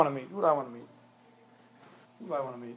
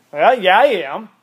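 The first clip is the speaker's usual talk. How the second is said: put-on defiant kid; 8 dB louder, slower than usual. Just direct defiance.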